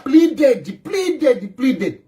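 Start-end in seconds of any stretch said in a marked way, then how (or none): none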